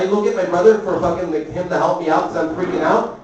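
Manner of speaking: usual speaking voice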